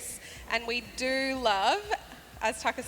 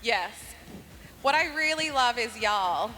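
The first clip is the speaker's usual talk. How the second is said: usual speaking voice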